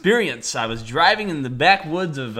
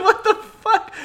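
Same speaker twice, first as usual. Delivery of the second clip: disbelief; slower than usual. Retold or imitated. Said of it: "I'm like".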